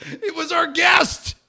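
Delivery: high, slow shouted punchline; real high-pitched outburst; incredulous shout. Real, not acted. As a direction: yell the twist in disbelief. Shouts the twist of the story.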